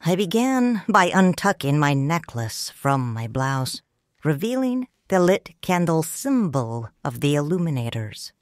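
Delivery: ordinary delivery, nothing marked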